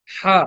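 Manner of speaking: হা said lightly with a single beat of vowel, not long; light short ha. Shown as a demonstration. Teacher showing students a short, light হা. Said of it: "লম্বা না, হালকা এক আলিফটা".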